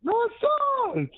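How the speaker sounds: drawn-out, very high denial; pleading high-voiced contradiction; high-pitched protest. Real, not acted. Protesting co-host's claim that it's over.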